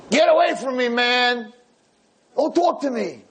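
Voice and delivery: put-on high, snapping grouchy old man; grumpy old man. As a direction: push him away like a miserable old grump. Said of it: "the most miserable, grisly old man".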